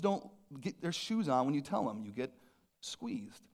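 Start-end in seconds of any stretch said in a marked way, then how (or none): 2.0-3.5 whispered, breathless "squeezed". Acted. Repeated refrain, whispered.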